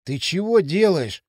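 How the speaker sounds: slow, whining protest; a touch of panicked bleating; mild distressed wail. A narrator's reading. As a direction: complain like a frightened victim. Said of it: "заблажил пельмень".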